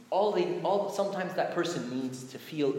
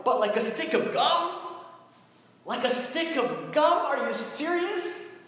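scandalized, rising mock astonishment; mock incredulity; 13 semitones higher, 3 dB louder than usual. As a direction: question it as if utterly absurd. Mock disbelief at petty theft.

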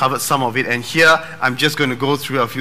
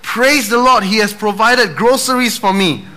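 joyful shout; 6 semitones higher than usual. Acted. Old lady on her porch praising God for the groceries. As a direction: shout it with triumph. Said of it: "she shouted".

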